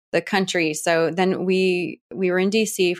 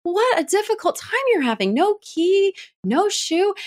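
much higher, sing-song pitying coo; syrupy pity; 11 semitones higher than usual. Retold or imitated. New neighbours cooing pity at the shoeless boy. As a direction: pour on the pity, hammed-up. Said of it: "And we're like".

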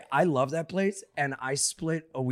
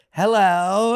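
imitating Jon Lovitz; 6 semitones higher, 7 dB louder than usual. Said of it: "he goes".

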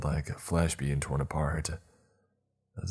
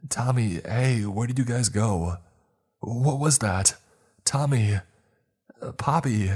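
calling out for friends, higher pitch; roepend; 7 semitones higher, 4 dB louder than usual. Acted. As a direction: call out to missing friends, urgently.

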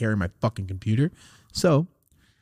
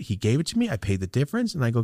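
ordinary delivery

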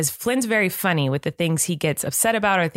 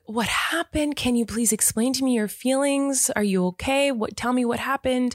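gentle parenting; 7 semitones higher than usual. Retold or imitated. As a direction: coax a crying toddler gently in a raised pitch. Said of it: "adjust my parenting as much as I can to this gentle".

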